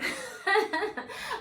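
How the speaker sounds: quick high-pitched laugh; bright laugh. Real, not acted. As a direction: burst out laughing. Laughs at own joke.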